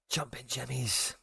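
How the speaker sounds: slow whispered mock curse; exasperated whisper. Real, not acted. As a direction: breathe it out, defeated. Exasperated after bad pick.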